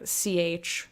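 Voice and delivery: ordinary talk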